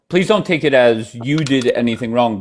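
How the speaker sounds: ordinary talk, nothing marked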